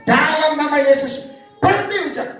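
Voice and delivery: high, drawn-out command; commanding prayer. Retold or imitated. Preacher orders the rain to stop in Jesus' name, commanding. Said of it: "Perintahkan berhenti".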